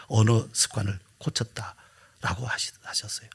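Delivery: slow, whispered close; softly whispered ending; trailing whisper. Real, not acted. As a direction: let it fade to a whisper.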